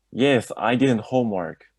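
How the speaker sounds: usual speaking voice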